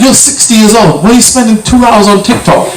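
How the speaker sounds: retold telling-off, mildly exasperated; mock exasperation. Retold disbelief at mother's phone habit.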